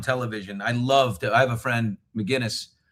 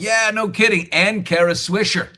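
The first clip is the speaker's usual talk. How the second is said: mock piling on; 8 semitones higher, 6 dB louder than usual. Voices commenters piling on.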